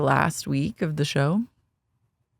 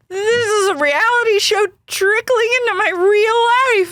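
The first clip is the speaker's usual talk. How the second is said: high, hammed-up whiny Shannon voice; mock Shannon meltdown. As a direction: parody her melting down, pitched way up. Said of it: "She goes".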